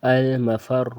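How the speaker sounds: المفر with a thick heavy ra, drawn out. Shown as a demonstration. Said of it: "اس کو موٹا پڑھیں گے". (المفر میں را موٹا پڑھیں)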